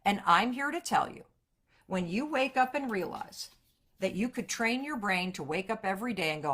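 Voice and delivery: normal talking voice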